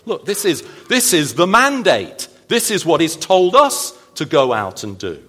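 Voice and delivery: rising into a loud protest; imagined objection. Retold or imitated. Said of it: "Now we might say".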